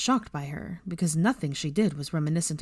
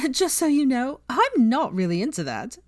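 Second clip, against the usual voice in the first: higher, louder teasing voice; Pansy, teasing; 8 semitones higher, 4 dB louder than usual. A narrator's reading. Pansy teasing Draco and Hermione, higher and mocking. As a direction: needle them with a grin.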